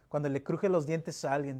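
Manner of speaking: usual speaking voice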